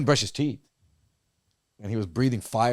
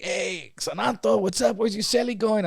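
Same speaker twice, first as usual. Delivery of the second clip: higher voice calling across the cells; calling down the row; 9 semitones higher than usual. Retold or imitated. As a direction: shout a question to a neighbor's cell.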